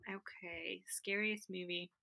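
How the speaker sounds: thinking over the scariest movie; whispered to herself. Real, not acted. Thinking aloud under her breath.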